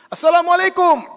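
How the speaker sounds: loud, high, ringing greeting; shouted greeting. Retold or imitated. Police chief greeting the idol, loud high shout. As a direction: bellow the salam across the temple. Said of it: "Karena dia teriak keras".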